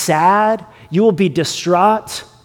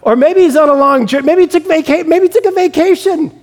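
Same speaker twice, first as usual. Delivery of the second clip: cheeky mocking; 8 semitones higher than usual. Elijah taunting Baal's prophets, cheeky high mockery. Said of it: "he gets a little cheeky".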